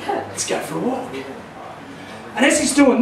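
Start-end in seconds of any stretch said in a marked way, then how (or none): 0.1-1.1 smooth ferret. Retold or imitated. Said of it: "He's thinking".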